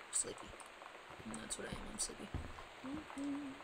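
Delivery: soft, drowsy whisper; sleepy whisper. Real, not acted. Tired gamer admitting to herself she's sleepy, whispered. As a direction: let it sag out in a tired whisper. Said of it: "so tired".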